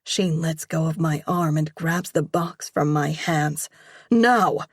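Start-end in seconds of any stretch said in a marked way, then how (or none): 4.1-4.6 loud, clipped command from mom; angry mom snapping an order; sharp order. Acted. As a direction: bark it at the daughter. Mom orders daughter inside.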